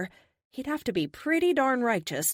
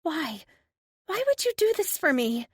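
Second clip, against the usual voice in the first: very high, tearful plea; high voice near tears; surprisingly high voice; 11 semitones higher, 4 dB louder than usual. Acted. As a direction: climb up high on the question. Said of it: "Silent tears spill down her cheeks".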